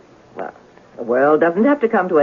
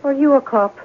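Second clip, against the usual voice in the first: suspicious; 4 semitones higher than usual. Acted. Suspicious landlady turns hostile.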